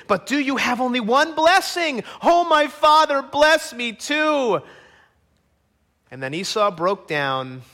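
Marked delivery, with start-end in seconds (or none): 0.1-4.6 acted anguished plea, stretched at the end; pleading. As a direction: beg a father desperately, pitch up. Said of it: "Esau pleaded".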